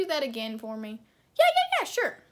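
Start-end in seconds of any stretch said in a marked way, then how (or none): 1.4-2.2 high, loud chirpy doctor voice; hammed-up cheerful eye doctor; high mock doctor. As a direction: squeak it like an eager doctor.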